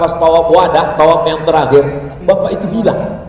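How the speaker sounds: ordinary talk, nothing marked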